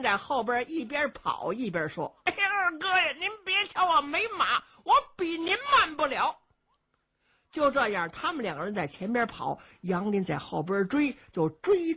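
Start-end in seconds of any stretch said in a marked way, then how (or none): 2.3-6.4 put-on high, childlike taunting of the old man; a simpleton teasing his foe, raised voice; big simple lad's voice. A narrator's reading. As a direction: play the dim-witted strongman, gleeful and high. Luo Shixin answering while running.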